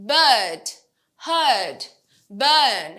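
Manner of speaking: British BIRD, HEARD, BURN with silent r; British long er vowel, r dropped; British accent. Shown as a demonstration. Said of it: "British অ্যাকসেন্টে আরটা উচ্চারণ হয় না".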